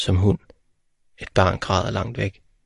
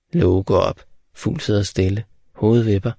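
usual speaking voice

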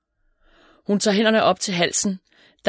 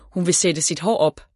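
ordinary delivery

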